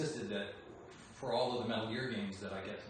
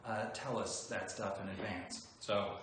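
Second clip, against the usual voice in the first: ordinary delivery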